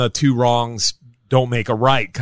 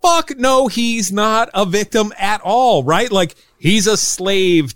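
worked-up rant; 8 semitones higher than usual. Real, not acted. Podcast host ranting about Dracula, heated and high. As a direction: get fired up and let it rip.

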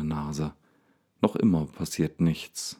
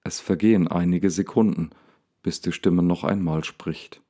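ordinary delivery

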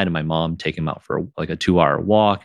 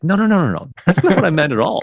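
emphatic denial; 11 semitones higher, 3 dB louder, faster than usual. Retold or imitated. Retold denial to upset partner.

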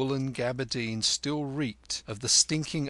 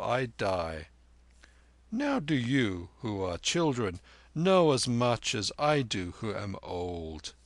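measured, emphatic elder; old Tibetan lama; 2 semitones higher, 3 dB louder than usual. Acted. Narrator plays the old lama.

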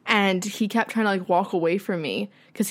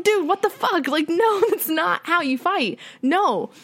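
outraged disbelief; 9 semitones higher, slower than usual. Real, not acted. Outraged at ex's behaviour.

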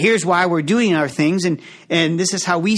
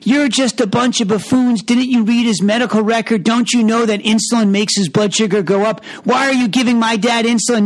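retold screaming daughter; 6 semitones higher than usual. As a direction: hurl the blame at the doctors. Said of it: "the patient's daughter is screaming".

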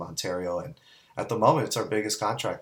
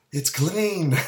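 high, louder astonished exclaim; amazed reveal; 8 semitones higher, 5 dB louder than usual. Retold or imitated. Crew staring into the washed bin, high amazed exclaim. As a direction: blurt it out in disbelief.

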